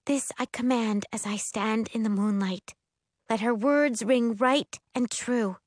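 high-pitched, measured incantation; voiced, deliberate spell casting; spell recitation. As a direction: recite it solemnly in a girl's high voice.

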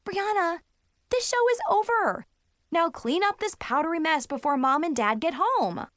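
high-pitched, exasperated big-sister command; irritated ordering. Acted. Big sister ends the magic show, irritated. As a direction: lay down the law, fed up.

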